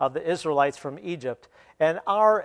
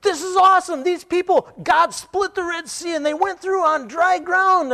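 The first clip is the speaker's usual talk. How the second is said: much higher, louder stoked dude voice; excited surfer dude; 14 semitones higher, 5 dB louder than usual. Retold or imitated. Young man telling the scholar about the Red Sea, excited dude voice. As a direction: gush like a thrilled surfer kid. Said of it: "He says".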